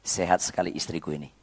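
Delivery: quiet tongue-in-cheek prayer; mock prayer. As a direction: pray half-jokingly about the wife. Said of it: "Seraya berkata".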